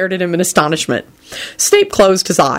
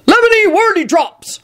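shouted password. A narrator's reading. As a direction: call it up at a stone gargoyle. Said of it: "shouted Hagrid into the stone gargoyle".

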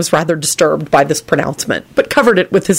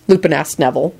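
ordinary delivery

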